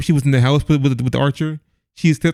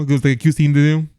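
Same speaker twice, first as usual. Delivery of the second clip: ordinary talk, nothing marked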